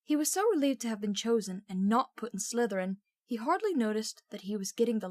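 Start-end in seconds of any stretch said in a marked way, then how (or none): none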